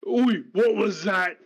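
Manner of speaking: an offended brute turns around; gruff orc challenge. Acted. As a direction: orc overhearing an insult, loud and slow. Orc overhears insult.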